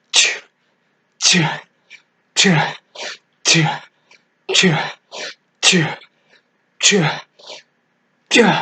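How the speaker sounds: short explosive exhale on each rep; exerted bursts of breath; push-up effort noises. Real, not acted. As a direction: push a sharp "tchuh" out with the effort.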